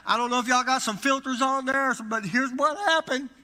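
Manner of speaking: loud, high-pitched retold phone call; urgent alarm. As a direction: imitate blurting a frantic warning to the pastor. Acted urgent call to his pastor.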